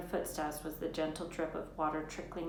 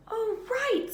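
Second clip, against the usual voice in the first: realizing; 8 dB louder than usual. A narrator's reading. Hermione realizing.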